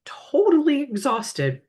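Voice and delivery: high, breaking voice on the edge of a whisper; real strained voice cutting out; voice giving out. Real, not acted. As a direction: let the voice crack and lose its sound. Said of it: "my voice is gonna keep going out".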